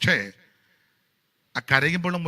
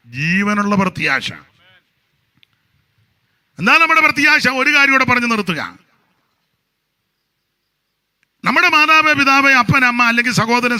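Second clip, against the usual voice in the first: loud, high, fervent sermon delivery; full-force preaching; 4 semitones higher, 11 dB louder than usual. Acted. Preacher thundering to the congregation with fervent loud preaching. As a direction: preach it out to the whole church.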